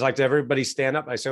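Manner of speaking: normal talking voice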